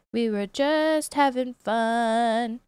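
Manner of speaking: slow, drawn-out lament; sadness. Pretend. Scene: streamer mourning a broken game, slow sad repeat. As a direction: dwell mournfully on each word. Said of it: "The sadness is real".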